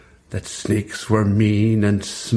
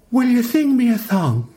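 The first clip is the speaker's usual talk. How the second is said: put-on much higher, small hopeful child's voice; hopeful little brother; 12 semitones higher than usual. A narrator's reading. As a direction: ask like a shy little boy.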